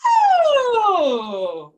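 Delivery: loud oh sliding downward; vocal slide. Shown as a demonstration. Teacher shows the vocal warm-up slide. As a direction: drop your voice like a falling acorn. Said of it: "do it with your voice".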